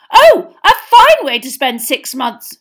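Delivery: very loud, high, slow sarcasm; furious drawn-out retort; shrieking. A narrator's reading. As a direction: shriek it scornfully at the children. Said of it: "she shrieked".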